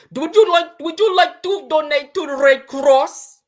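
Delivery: mocking the cashier asking for a Red Cross donation; mock clerk accent. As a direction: drag out the donation ask in a put-on accent. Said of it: "Them motherfuckers".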